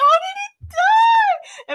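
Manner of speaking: very high, drawn-out fake wail; mock crying. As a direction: imitate a sobbing child, slowly. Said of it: "I was, like, crying".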